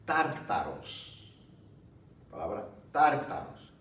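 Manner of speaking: drawn-out tártaros, each syllable slow; slow word demo. Shown as a demonstration. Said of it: "Y era la palabra". ("tártaros" dicho muy despacio)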